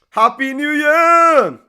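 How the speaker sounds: high, loud, drawn-out cheer; excited shout. Real, not acted. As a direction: shout it out to the whole audience. Festive shout to viewers.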